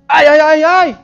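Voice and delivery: high, loud mock whimpering ay ay ay; exaggerated comic yelps of a crybaby; whiny pained cries. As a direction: ham up a big baby whining in pain. Blind man whining as mud applied.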